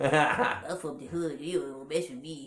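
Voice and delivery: low, congested cat voice; post-nasal drip cat. Acted. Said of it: "he talked like this".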